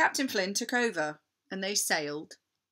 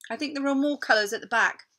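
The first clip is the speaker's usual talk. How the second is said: bright, quick teacher's call; a cheerful teacher calling across the class; 7 semitones higher, 6 dB louder, faster than usual. A narrator's reading. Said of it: "said Miss Pye's teacher".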